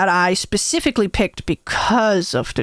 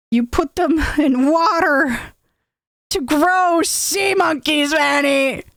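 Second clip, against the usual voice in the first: higher, slowing, incredulous explanation; aghast; 6 semitones higher than usual. Retold or imitated. Brother explains sea monkeys to Vanny, aghast. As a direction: spell it out in mock disbelief.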